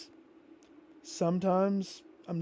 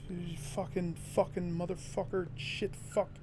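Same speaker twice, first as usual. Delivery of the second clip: seething swearing; 8 dB quieter than usual. Real, not acted. Muttered swearing after rage.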